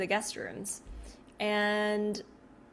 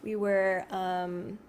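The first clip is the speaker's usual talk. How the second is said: usual speaking voice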